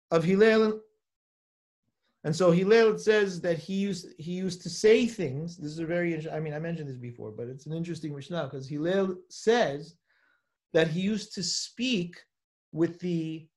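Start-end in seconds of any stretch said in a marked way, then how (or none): none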